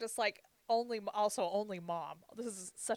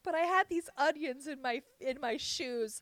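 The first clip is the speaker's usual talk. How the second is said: higher, louder whiny excuse voice; sister's excuse; 9 semitones higher, 9 dB louder than usual. Retold or imitated. Sister explaining to the clinic why she showered first, in a higher, louder voice. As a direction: plead innocently in a raised voice.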